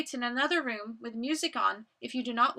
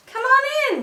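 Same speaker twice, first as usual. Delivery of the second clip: high, loud, quick welcome; excited greeting; 10 semitones higher, 9 dB louder than usual. Acted. Welcoming visitors at the door.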